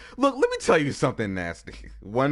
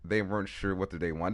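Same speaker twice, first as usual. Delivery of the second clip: normal talking voice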